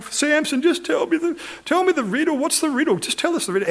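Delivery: high-pitched whiny pleading, put on; mock crying. Girl begging Samson for the riddle, mock crying. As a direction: imitate a tearful bride nagging for an answer. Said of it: "The girl starts crying".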